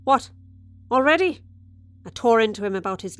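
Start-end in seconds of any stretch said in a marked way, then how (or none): 0.0-1.4 annoyed husband. Retold or imitated. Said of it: "He said".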